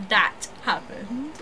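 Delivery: put-on slow valley girl drawl. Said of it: "now she sounds like a valley girl".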